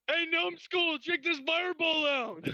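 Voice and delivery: high, hammed-up yell; screaming taunt. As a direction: yell a taunt to grab a foe's attention. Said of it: "I'm screaming at the top of my lungs".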